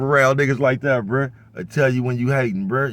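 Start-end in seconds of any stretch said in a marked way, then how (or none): none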